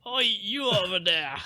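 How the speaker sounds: hailing in a mock Scottish accent; put-on Scottish "oi". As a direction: call out like a cheeky Scot. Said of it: "Scottish accent".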